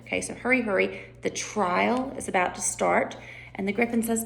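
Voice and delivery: ordinary talk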